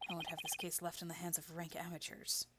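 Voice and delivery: low, very quiet, disdainful voice; aloof rival. Acted. Rival student dismissing the other lawyers, low and disdainful. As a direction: look down on the amateurs under your breath.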